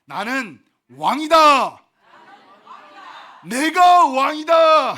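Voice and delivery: slow, loud shout of I am king; shouted declaration. Shown as a demonstration. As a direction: proclaim it at full voice for the crowd to repeat. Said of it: "저를 따라 또 외치겠습니다". (회중이 따라 외치도록 우렁차게 선포)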